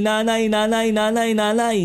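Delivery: ordinary delivery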